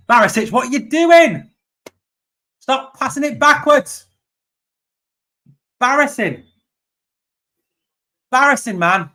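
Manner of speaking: real fan rage at the screen; furious shout. Real, not acted. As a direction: scream at the players in frustration. Yelling at Barisic's back passes.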